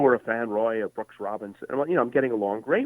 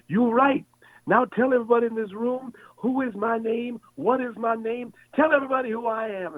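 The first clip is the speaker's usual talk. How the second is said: much higher, big showman's cry to the crowd; Muhammad Ali's rallying boast; 13 semitones higher than usual. Retold or imitated. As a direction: whip up the whole room like the champ. Ali addressing the room.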